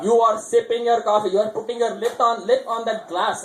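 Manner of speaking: scolding mom. Retold or imitated. Mother scolding her son over coffee, mock stern. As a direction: lay down the rule like a strict mother.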